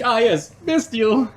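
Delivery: high, drawn-out, smug quote. Retold or imitated. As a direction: savour it like a sure win. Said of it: "And I was like".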